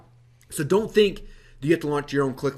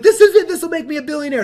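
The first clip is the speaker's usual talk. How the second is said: much higher and louder, laughing excitement; excited billionaire dream; 13 semitones higher, 9 dB louder than usual. Retold or imitated. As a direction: burst out giddy about the big idea. Recalls excitement over new product.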